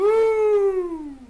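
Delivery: loud high whoop; excited whoop. Real, not acted. Whooping at Ouija board mention.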